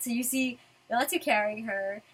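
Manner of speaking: ordinary talk, nothing marked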